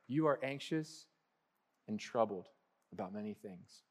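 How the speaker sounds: hushed, caring address; tender. Retold or imitated. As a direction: get her attention with care, not scolding. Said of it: "Jesus really being tender".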